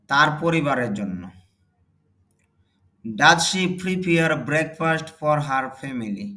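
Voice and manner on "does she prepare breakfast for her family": slow model reading. Shown as a demonstration. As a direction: dictate it word by word for learners. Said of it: "teacher models English sentence slowly". (ধীরে ধীরে জোরে পড়া)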